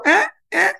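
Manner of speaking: a high, loud knowing "Eh, eh"; a loud knowing "Eh, eh" that warns her son; knowing warning grunt. Retold or imitated. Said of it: "Yeah, I know when you say that".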